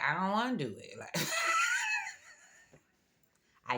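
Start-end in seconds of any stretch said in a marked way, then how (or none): 0.0-1.0 defiant whine. Retold or imitated. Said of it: "I'd be like". 1.2-2.3 loud laughing cackle. Real, not acted. Laughing at own defiance.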